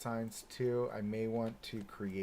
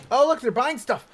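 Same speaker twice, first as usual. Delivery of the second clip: higher, louder, delighted outburst; excited; 12 semitones higher, 14 dB louder than usual. Real, not acted. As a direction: burst out with delight. Spots guests buying things.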